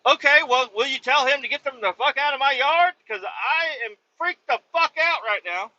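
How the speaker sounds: half panicked request to get the wolves away; freaked out. Retold or imitated. Trucker on phone demanding wolves removed, rattled high plea. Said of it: "I was like".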